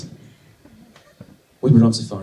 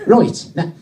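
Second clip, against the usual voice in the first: higher, louder mock Irish accent; 8 semitones higher, 5 dB louder than usual. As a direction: punch it out in put-on Irish. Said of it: "So he's going to be Irish".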